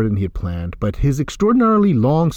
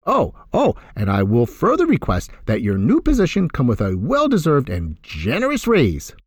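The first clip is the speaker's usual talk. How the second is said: sing-song; 8 semitones higher than usual. Acted. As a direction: tease him with a singing lilt. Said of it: "his voice almost sing-song".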